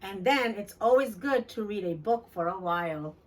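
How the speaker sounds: ordinary delivery, nothing marked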